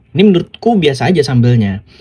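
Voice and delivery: ordinary delivery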